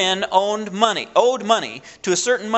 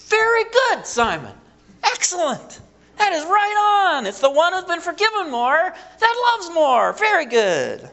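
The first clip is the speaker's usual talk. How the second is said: emphatic praise; 11 semitones higher, slower than usual. Retold or imitated. Jesus praising Simon's answer, much higher and emphatic. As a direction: gush with exaggerated approval. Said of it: "it's real emphatic".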